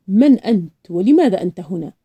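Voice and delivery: loud, demanding questioning; stern interrogation. A narrator's reading. Police chief questions the grandfather's presence, loud and stern. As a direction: fire the questions like an officer in charge.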